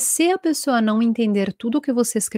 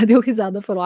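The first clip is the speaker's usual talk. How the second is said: normal talking voice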